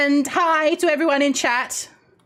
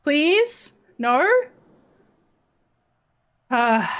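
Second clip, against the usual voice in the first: normal talking voice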